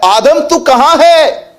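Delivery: high, slow calling voice; God calling out. Acted. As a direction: call out slowly and high like God searching. God calling Adam in sermon. (ऊँची, धीमी पुकार)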